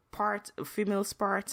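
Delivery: normal talking voice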